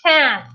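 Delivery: "Cat" said as English cat with a closed, tight vowel; English pronunciation. Shown as a demonstration. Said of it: "cat, tá certa a pronúncia?".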